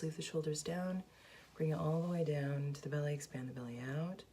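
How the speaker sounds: soft, hushed meditation voice; guided meditation. Real, not acted. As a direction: guide them gently into calm, very softly. Leads a guided breathing meditation.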